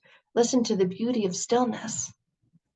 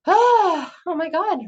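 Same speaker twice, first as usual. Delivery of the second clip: mock exasperated cry, much higher and louder; 10 semitones higher, 7 dB louder, slower than usual. Mocking a self-pitying person at the breaking point, exasperated wail. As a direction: throw the hands up with a hammed-up wail.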